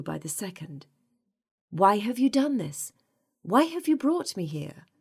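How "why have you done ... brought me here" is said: high, loud, distressed questioning; alarmed demand. A narrator's reading. Captive asking why she was brought here, alarmed. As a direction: demand answers, climbing higher in alarm.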